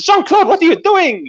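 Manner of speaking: put-on very high French-accented squeal; alarmed Frenchwoman. Woman to the pilot setting her on fire, alarmed. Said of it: "And says".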